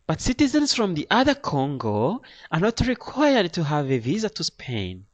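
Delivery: high, loud explaining clerk; high-pitched imitation of an official; Spanish lady official. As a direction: explain the rule in the lady's high voice. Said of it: "another lady asks in a Spanish accent".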